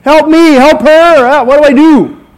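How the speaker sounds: hammed-up panicked father; flustered dad praying.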